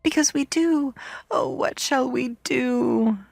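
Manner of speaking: put-on high, wailing children's plaint; children's despairing cry, high and plaintive; crying out together. A narrator's reading. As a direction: wail helplessly, drawing out the end. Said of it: "they cried together".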